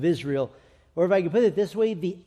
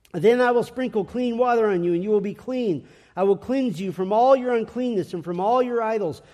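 forceful scripture reading; 3 semitones higher than usual. Acted. Preacher reading Ezekiel aloud to his congregation, forceful. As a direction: proclaim the promises with rising fervour.

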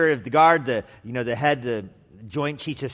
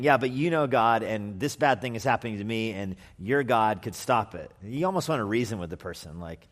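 ordinary talk

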